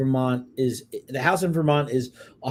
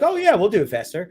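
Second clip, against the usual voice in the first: mock eager contractor; 6 semitones higher, 4 dB louder, faster than usual. Mimicking contractor's eager reply.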